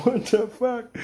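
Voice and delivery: high-pitched baffled reaction; retold disbelief. Bouncer watching his partner strut around, incredulous. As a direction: voice jumps way up in mock disbelief.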